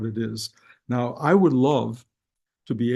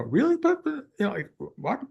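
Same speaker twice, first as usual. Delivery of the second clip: high, baffled stammer; incredulous; 9 semitones higher than usual. Retold or imitated. Answering his inner voice in high disbelief. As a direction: squeak up in disbelief at the message.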